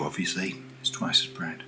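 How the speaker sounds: ordinary delivery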